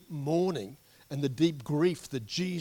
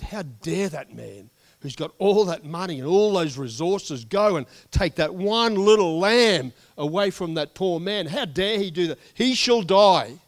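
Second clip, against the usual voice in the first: angry David; 5 semitones higher, 10 dB louder than usual. Retold or imitated. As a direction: thunder out a death sentence in outrage. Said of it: "And David got angry".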